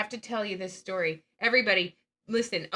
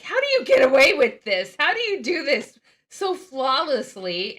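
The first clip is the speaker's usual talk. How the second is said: amazed boss; 4 semitones higher, 10 dB louder than usual. Retold or imitated. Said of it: "she was like".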